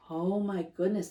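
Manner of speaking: ordinary delivery, nothing marked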